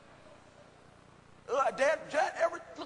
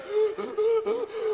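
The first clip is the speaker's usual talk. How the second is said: hammed-up wheezing gasp, much higher; badly faked gasping for air; 11 semitones higher, 4 dB louder than usual. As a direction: fake an asthma attack. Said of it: "try to fake everything".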